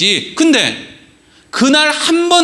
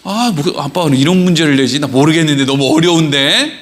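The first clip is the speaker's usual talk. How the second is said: low, quick mock grumble; lightly voiced baffled child; stumped child grumbling; 7 semitones lower than usual. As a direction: imitate a frustrated kid puzzling aloud. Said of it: "너무 답답하게".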